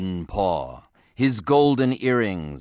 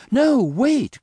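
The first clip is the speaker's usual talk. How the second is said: high-pitched pleading yelp; alarmed cry; 4 dB louder than usual. A narrator's reading. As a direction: cry out to stop them, voice way up. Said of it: "cried Henry".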